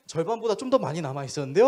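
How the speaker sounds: loud, quick, oblivious answer; innocently cheerful. Retold or imitated. Young man reporting date's leftovers to pastor, blithely cheerful. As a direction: imitate a guileless young man, blissfully unaware. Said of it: "되게 해맑게 얘기를 하는".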